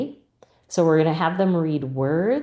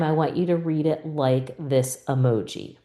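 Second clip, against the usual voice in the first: ordinary talk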